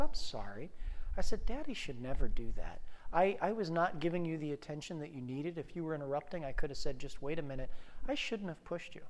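soft, low, tender apology; gentle apology. Retold or imitated. Dad to three-year-old daughter on his lap, gently apologetic. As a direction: speak softly and soothingly to a little child.

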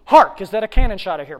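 loud, punched HARK; declaimed rehearsal. Acted. Actor rehearsing all day, loudly declaimed. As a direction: belt out the stage line.

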